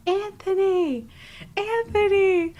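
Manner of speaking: loud, high call across the hallway; screaming a name. Retold or imitated. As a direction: yell his name to get his attention. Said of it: "And I start screaming".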